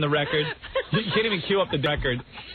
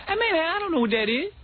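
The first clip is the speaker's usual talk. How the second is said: high put-on street voice; mock stupid answer in slang; playing dumb in slang; 14 semitones higher than usual. Kid playing dumb when the teacher calls on him. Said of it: "So I'd be like".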